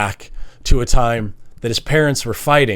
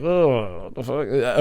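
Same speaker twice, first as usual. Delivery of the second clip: softer, under the breath shock; muttered reaction; 6 dB quieter, slower than usual. Retold or imitated. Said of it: "I was like".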